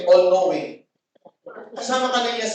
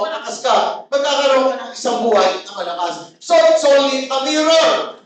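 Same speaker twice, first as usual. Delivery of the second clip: louder, driving sermon delivery; forceful preaching; 3 semitones higher, 3 dB louder, faster than usual. Real, not acted. Pastor urging the congregation about inner strength, forceful preaching. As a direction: preach it hard to the room.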